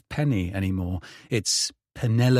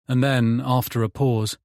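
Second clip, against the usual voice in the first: plain reading voice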